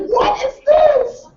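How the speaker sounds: high, slow, incredulous mock cry at himself; baffled. Speaker baffled by what he just said, high mock shout. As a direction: shout in disbelief at his own words. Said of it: "I'm like".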